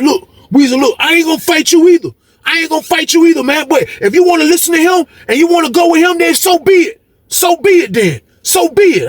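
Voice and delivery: high-pitched, worked-up protest; getting loud. Retold or imitated. Cellmate refusing to fight Weezy, worked-up and high-pitched. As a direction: act out a cellmate kicking up a fuss. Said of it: "he started getting loud".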